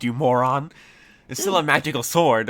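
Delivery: loud, high, irritated leprechaun; stating the obvious in loud annoyance; exasperated scolding. Acted.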